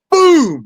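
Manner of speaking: very high loud boom; punch sound. Retold or imitated. Fighter sounding out his landed punch, high loud boom. Landing a right hand.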